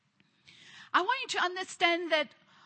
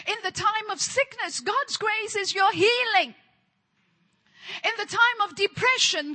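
rising preached litany, punched and louder; fervent preaching; 5 semitones higher, 3 dB louder than usual. Acted. Preacher proclaiming grace in every trial, fervent and louder. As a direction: preach it to the congregation with force.

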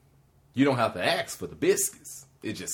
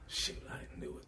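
muttered to self; 7 dB quieter than usual. Retold or imitated. Disappointed at empty ATM.

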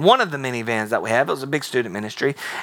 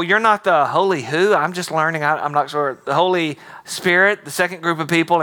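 usual speaking voice

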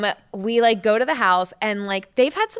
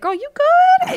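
much higher, louder, checking in; high concerned question; 7 dB louder, slower than usual. Retold or imitated. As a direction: call out to her with worry.

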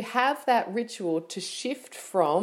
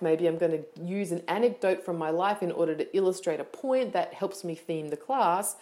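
ordinary talk, nothing marked

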